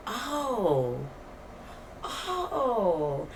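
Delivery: drawn-out, falling "oh"; mock surprise. Storyteller reacting to roommate's outburst, drawn-out mock surprise. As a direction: savor each "oh" in mock amazement.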